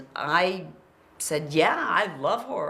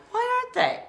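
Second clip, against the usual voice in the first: very high, baffled whine; indignant disbelief; much faster than usual. Retold or imitated. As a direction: squeak it out in offended surprise. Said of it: "you go through this thing like".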